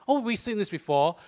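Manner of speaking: ordinary talk, nothing marked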